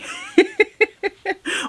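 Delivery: high, rippling giggle; amused giggle. Real, not acted. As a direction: break into a long high giggle. Laughs at her own remark.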